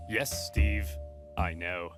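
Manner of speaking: low, self-satisfied reply; smug general. Acted. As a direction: accept the praise smugly. Regulus answers smugly.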